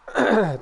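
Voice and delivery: sharp throat clear after nearly choking. Real, not acted. Nearly choked while eating on stream, real throat clear. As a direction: cough the food out of your throat. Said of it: "nearly choked on my own food".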